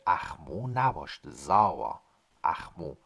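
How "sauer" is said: Sauer punched out, louder than the lesson voice; sauer stressed. Shown as a demonstration. Teacher singles out the new word Sauer with force. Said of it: "teacher isolates the word".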